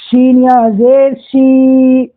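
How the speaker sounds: سین یا زیر شی drawn out slowly, sounds stretched; slow letter demo. Shown as a demonstration. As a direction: spell it out slowly. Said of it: "آواز کو موٹی کرنی پڑے گی". (حروف کو آہستہ کھینچ کر)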